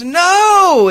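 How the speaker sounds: very high, loud wail of denial; anguished cry. Retold or imitated. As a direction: cry out as if the pet were lost. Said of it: "she goes".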